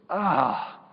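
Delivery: put-on loud, high, relieved "Ah" of a restless child; bored kid's loud sigh. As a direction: let it out like a kid finally freed. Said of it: "leans back in his chair and he goes".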